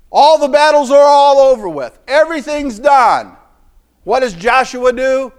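climbing, loud sermon cry; full-force preaching. Acted. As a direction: build the congregation up to the peak.

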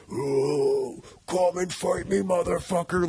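high mock hardcore-vocalist voice; nineties hardcore taunt. Describing the songs' hardcore feel, high mock hardcore taunt. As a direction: put on a drawn-out punk challenge. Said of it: "like, nineties hardcore feel to it".

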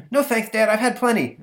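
put-on high kid voice; breezy kid brushing dad off; boy's voice. Stanley answering his father, high boyish voice. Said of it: "Stanley voiced by narrator".

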